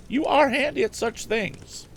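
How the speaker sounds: high, slow, admiring woman's voice; high, slow, admiring farm woman; farm wife voice. A narrator's reading. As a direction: praise him slowly in a high voice. Farm wife praises Tom.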